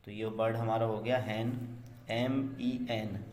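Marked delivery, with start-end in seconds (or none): 2.1-3.2 spelled out. Shown as a demonstration. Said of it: "spelling a word letter by letter".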